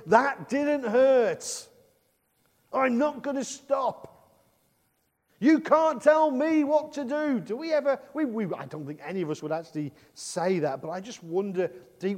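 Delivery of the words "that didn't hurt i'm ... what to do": slow, higher, louder defiance; defiant shout at God. Retold or imitated. Believers defying God's discipline, higher and louder. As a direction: shake a fist at heaven. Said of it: "shake our puny little fist and say".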